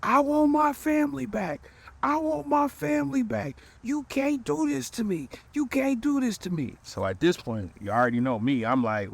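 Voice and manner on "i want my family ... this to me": imitating a sobbing plea, pitched way up; mock wailing crybaby, high and strained; hammed-up tearful begging for his family back; crying. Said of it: "bust out crying".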